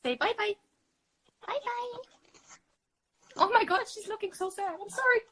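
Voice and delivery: high, sing-song bye-bye to a cat. Real, not acted. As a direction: coo goodbye to the kitty. Baby talk to cat.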